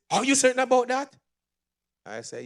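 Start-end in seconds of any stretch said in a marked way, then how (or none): 0.1-1.1 much higher, louder and fast; loud, high retold challenge; imitated loud question. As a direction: fire it back like a suspicious patient. Patient shouting back in story.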